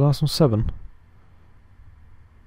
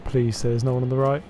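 usual speaking voice

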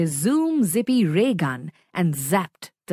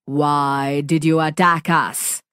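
lower, louder monster voice; deep booming voice; 5 semitones lower, 3 dB louder than usual. Acted. Monster demanding why the friends attacked, deep booming. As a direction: boom it like a big alien. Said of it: "boomed a deep, dark voice".

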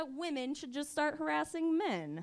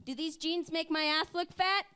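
put-on insecure girlfriend; 7 semitones higher than usual. Fake girlfriend asking a man about her jeans, mock hurt. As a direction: whine it like a clingy girlfriend. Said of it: "here's the next one".

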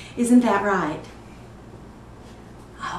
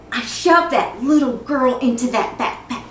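loud, punched-out telling; forceful confession; 3 semitones higher, 8 dB louder than usual. Acted. Mother confessing she put the girl in the backpack, forceful. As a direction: relive the shove with sudden force.

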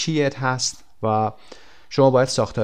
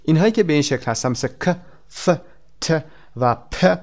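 ordinary talk

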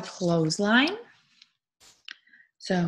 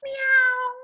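high-pitched, loud meow; cat meow; 5 dB louder than usual. Acted. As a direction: meow like a cat for little children. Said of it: "a cat goes".

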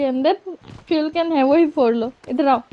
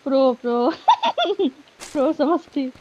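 amused laugh into the words. Real, not acted. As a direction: laugh off the teasing. Laughs while teased as pro. (हँसते हुए)

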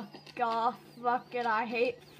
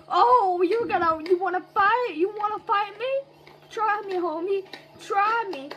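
higher, louder, taunting fight challenge; 5 semitones higher, 3 dB louder than usual. Acted. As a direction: square up and dare them.

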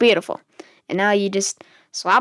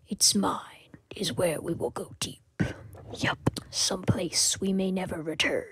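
nature documentary; 8 dB quieter than usual. Acted. Hushed wildlife narration about hot chocolate.

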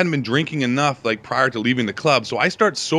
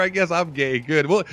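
usual speaking voice

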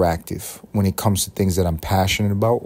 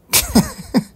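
high burst of laughter; 3 dB louder than usual. Real, not acted. Laughs at own joke.

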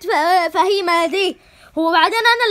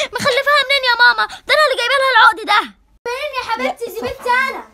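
heated quarrel; 6 semitones higher than usual. Acted. Two women quarrelling over girl.

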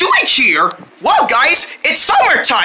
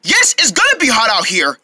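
ordinary talk, nothing marked

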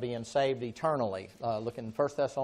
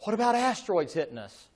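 mock worried question; 9 semitones higher, 6 dB louder than usual. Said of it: "You say".

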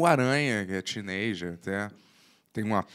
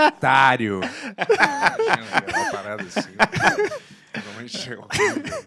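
talking through real laughter; helpless laughter breaking into the speech; laughing; 9 semitones higher, 4 dB louder, slower than usual. Real, not acted. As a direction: keep laughing as the words come out. Laughing through the crude joke.

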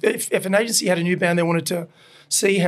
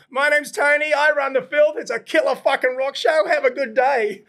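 stage announcement; 7 semitones higher than usual. Retold or imitated. Addressing festival crowd on stage.

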